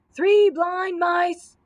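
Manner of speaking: high, loud, slow belted line; shouted. A narrator's reading. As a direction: bellow the song to keep awake. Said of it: "shouted Dorothy".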